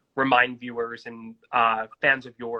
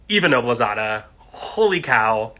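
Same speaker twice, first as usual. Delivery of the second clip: ordinary talk, nothing marked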